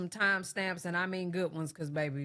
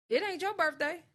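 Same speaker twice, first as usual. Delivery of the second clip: sassy; 12 semitones higher than usual. Acted. Sassy aside to drunk.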